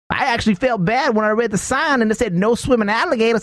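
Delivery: high Southern hillbilly pity. Acted. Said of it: "going back into the redneck voice".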